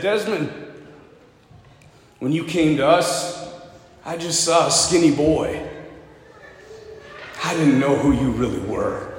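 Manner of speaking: understated, halting regret; embarrassed, near tears. Retold or imitated. Commanding officer apologises to the skinny medic, subdued and moved. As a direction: confess it gently with long pauses, a touch choked. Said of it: "embarrassed and almost in tears".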